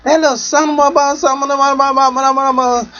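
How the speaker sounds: high, rising rant of half-swallowed curses; imitating her cursing. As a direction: splutter insults like an angry woman. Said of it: "she was cussing quite strongly".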